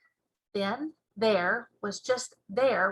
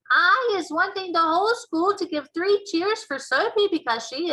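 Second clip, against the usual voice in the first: loud, high proud school assembly speech; head teacher announcing; 5 semitones higher, 5 dB louder than usual. Acted. Head teacher praising Sophie before the assembly, loud and proud. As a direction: proclaim it to the whole hall. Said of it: "standing up and saying".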